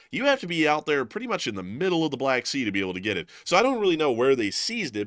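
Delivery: normal talking voice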